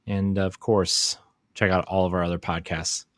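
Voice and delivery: ordinary talk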